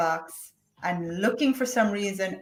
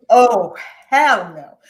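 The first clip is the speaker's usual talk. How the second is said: defiant hell no; 10 dB louder, slower than usual. Retold or imitated.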